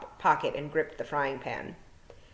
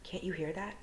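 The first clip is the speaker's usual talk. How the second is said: much softer, tense listening; tense hush; 2 semitones higher, 10 dB quieter than usual. A narrator's reading. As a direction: ask under her breath.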